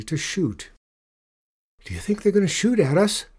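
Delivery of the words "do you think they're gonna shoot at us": fast, high, alarmed shout; shouted question. A narrator's reading. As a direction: shout it quickly over the wind. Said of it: "Alfie shouted".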